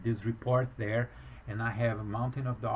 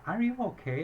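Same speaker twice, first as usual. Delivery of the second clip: much higher-pitched imitation of a worried question; heightened concern; 8 semitones higher than usual. Embassy clerk asks as crying worsens, high-pitched concern.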